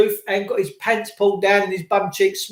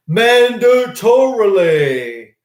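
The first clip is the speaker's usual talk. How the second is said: mandatorily stretched out slowly, syllable by syllable; drawn-out emphasis; 5 dB louder, much slower than usual. Real, not acted. Host warns viewers of forced testing, mandatorily drawn out scornfully. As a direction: hammer out mandatorily in long, separate syllables. Scornful stress on the letter's word.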